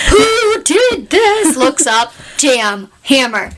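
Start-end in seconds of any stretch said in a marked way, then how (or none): 0.1-1.6 high, drawn-out furious queen; outraged queen. Acted. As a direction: demand it slowly like an enraged queen. Queen voiced in the retelling.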